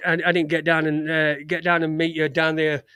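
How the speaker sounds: usual speaking voice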